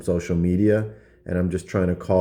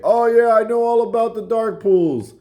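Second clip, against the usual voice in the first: squeaky high, loud know-it-all; high mock insider voice; 6 dB louder than usual. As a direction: play the eager employee spilling secrets. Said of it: "someone sooner or later is gonna say".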